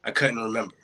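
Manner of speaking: rhythmic recital, rising in pitch as it heats up; spoken-word poem. Acted. Poem builds to hospital argument.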